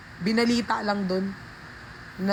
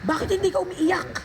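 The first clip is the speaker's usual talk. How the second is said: gayang boses ni ate; 8 semitones higher than usual. Retold or imitated. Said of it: "yung ate ko".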